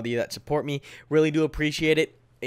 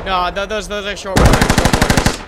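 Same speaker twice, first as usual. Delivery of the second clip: alarmed shout; 7 semitones higher, 10 dB louder than usual. Real, not acted. Alarm at short rounds.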